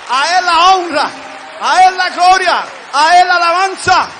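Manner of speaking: ringing triumphant praise in parallel cries; exultant praise. Real, not acted. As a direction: proclaim glory to the whole church. Leads praise at full force.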